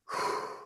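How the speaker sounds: quiet unvoiced exhale before speaking; calming breath. Shown as a demonstration. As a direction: let out a settling breath first. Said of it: "you would say".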